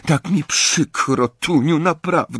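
put-on a girl pleading with her sister at the station. A narrator's reading. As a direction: beg her sister to forgive. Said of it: "błagalnym tonem".

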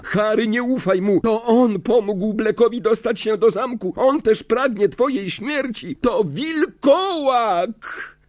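put-on high, frantic girl's voice; high-pitched, alarmed denunciation; screamed warning. A narrator's reading. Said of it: "krzyknęła Hermiona".

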